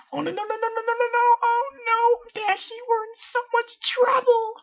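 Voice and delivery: very high, loud squealing panic; panicked Pinky. Acted. Pinky panics to Dashy in a very high squeal.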